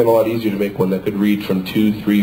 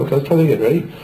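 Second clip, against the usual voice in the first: ordinary talk, nothing marked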